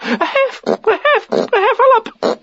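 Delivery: very high, panting, broken stammer; out of breath. A narrator's reading. Piglet reporting the heffalump, out of breath. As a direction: puff out the word in pieces. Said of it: "breathing so hard that he could hardly speak".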